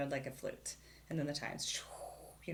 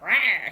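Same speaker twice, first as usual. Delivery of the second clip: loud playful growl; loud, higher mock animal growl; imitated growl; 5 semitones higher, 13 dB louder than usual. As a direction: growl out a big roar. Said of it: "He growled a bit".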